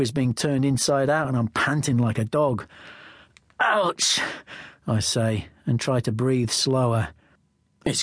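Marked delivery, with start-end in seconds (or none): 3.6-4.2 high yelp of pain; pained ouch. Acted. Narrator in pain from the finger, high pained yelp.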